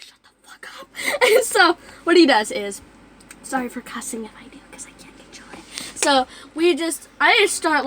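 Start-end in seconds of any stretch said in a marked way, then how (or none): 0.0-0.9 voiceless whisper; whispering a rude aside on camera. Real, not acted. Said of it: "whispered aside". 3.4-6.0 voiceless whisper; whispering a sheepish apology to viewers. Real, not acted. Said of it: "whispered apology".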